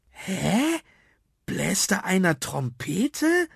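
high, drawn-out puzzled question; puzzled call. Acted. Emil hears a strange sound outside, puzzled high-pitched call. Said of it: "rief Emil".

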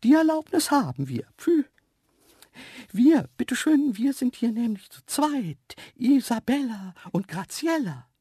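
very high dainty creature voice; dainty cheeky antelope. A narrator's reading. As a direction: pipe up cheekily as a delicate antelope. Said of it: "war das eine zarte Stimme". (zarte, freche, hohe Antilopenstimme)

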